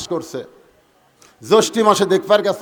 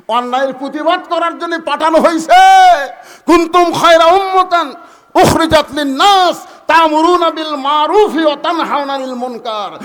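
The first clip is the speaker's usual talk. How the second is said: loud, high-pitched fiery sermon; ringing, raised preacher's voice; full-force preaching; 7 semitones higher, 10 dB louder than usual. Acted. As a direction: preach it out forcefully to a big crowd.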